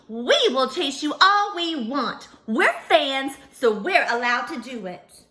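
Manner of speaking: loud, pushy fan voices. Acted. Fans shouting back at the pony, loud and pushy. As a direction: boom it out like a bossy crowd.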